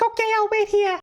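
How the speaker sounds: put-on very high falsetto girl voice; ditzy showgirl. Ditzy showgirl agrees to wait for her date, high falsetto. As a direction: play her as a squeaky airhead. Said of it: "she is so ditzy".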